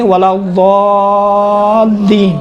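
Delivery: "الضالین" slow, ض close to a heavy d, very long level ā; ض like a dal. Shown as a demonstration. Teacher showing the ض sounds like dal, slow held recitation. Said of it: "دال جیسا ادا ہو رہا ہے".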